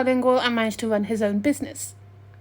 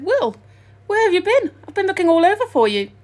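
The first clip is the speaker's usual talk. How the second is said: relieved call; 8 semitones higher, 6 dB louder than usual. A narrator's reading. Worried friend finally finds Will.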